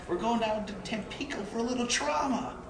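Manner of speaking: a light Buffett impression; imitating Buffett. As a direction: mimic how Buffett talks now. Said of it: "Buffett sounds like he does today".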